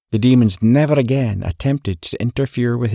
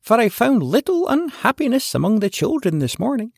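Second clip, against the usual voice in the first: very high, bright and delighted; pleased fairy voice; 9 semitones higher than usual. Acted. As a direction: squeak it out like a happy fairy. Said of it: "cried the fairy in a pleased voice".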